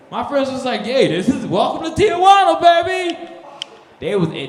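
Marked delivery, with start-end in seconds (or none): 0.8-3.2 loud high party shout; hyped cheer. Retold or imitated. Friends welcoming him to the club, loud high hype. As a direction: sell it like a hype man.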